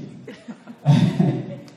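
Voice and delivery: short breathy laugh; brief laugh. Real, not acted. Speaker laughing with the class at his joke. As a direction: let a quick laugh slip out.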